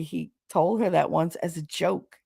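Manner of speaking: normal talking voice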